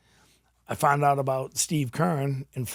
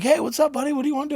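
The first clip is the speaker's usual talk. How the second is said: much higher-pitched, lively greeting; upbeat greeter; 12 semitones higher, 4 dB louder, faster than usual. Retold or imitated. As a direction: imitate an eager welcome, pitched way up. Quotes prosthetist greeting him.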